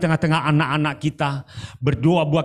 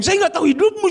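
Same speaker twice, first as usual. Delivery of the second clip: much higher, emphatic quoted denial; high quoted protest; 4 dB louder, faster than usual. Retold or imitated. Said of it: "saya bilang gitu". (penyangkalan bernada tinggi yang ditirukan)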